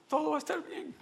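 put-on small, high, soft child's voice; imitated child, high and hushed; six-year-old son. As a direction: gently reassure Dad as a little boy. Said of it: "que tenía como unos seis años".

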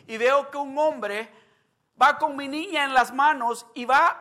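loud, high impassioned sermon retelling; preaching at full force. Acted. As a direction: build the story to a preaching climax. Preaches retold story at full force. (predicación a todo pulmón, fuerte y alta)

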